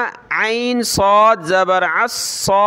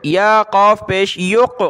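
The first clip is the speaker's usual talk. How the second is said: normal talking voice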